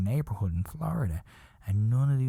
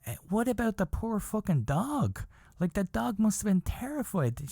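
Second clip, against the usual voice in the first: high-pitched appalled plea; indignant pity; 9 semitones higher than usual. Retold or imitated. Comic protesting to her on the dog's behalf, indignant.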